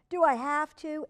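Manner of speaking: put-on boy resisting his brother, high and loud; whiny Elliot. A narrator's reading. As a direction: whine it like a kid. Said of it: "Elliot had planned".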